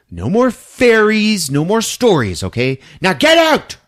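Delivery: angry dad yell. Retold or imitated. Father yelling at Judy to get out. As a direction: bark the order to leave.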